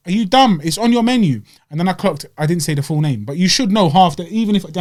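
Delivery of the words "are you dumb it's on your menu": louder and higher, annoyed; retold annoyance. Host retelling arguing with the waitress, annoyed. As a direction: snap at the waitress. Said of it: "I was like".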